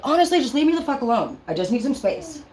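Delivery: high, exasperated brush-off; mock brush-off. You snapping at your partner or child, mock exasperated. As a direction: push them away with a huffy sigh. Acting how you treat people.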